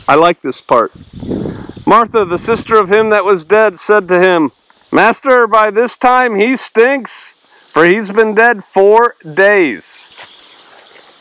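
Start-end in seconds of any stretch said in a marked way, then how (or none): 1.9-11.2 high, measured verse, stretched at the end; raised-pitch Bible recitation; scripture reading. Acted. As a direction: read it out slowly for effect. Reads scripture aloud.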